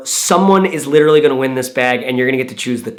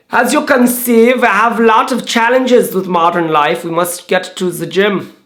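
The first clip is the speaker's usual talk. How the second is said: hammed-up German accent, higher and stiff; 5 semitones higher than usual. Vlogger imitating his German friend David, mock German accent. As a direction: play a cartoonish German bag designer. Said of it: "What's a German accent?".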